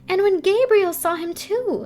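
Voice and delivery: high, loud eager telling; excited. A narrator's reading. Yamaz bursting in on the frightened girls, excited. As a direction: burst in with the news.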